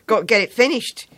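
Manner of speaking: put-on hurried kid who won't be stopped; urgent schoolboy. Boy sews urgently.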